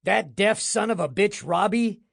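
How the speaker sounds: a mild shriek at the deaf projectionist. A narrator's reading. Boss cursing the deaf projectionist, mildly shrieked. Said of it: "he shrieked".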